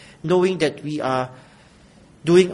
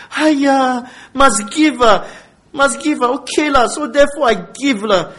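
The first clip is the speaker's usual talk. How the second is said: loud, higher-pitched reluctant whine; grudging complaint; 8 semitones higher, 6 dB louder than usual. Acted. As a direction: grumble it like a put-upon, unwilling giver. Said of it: "full of grief. Okay, it's not just complaint or full of grief. Or where we say".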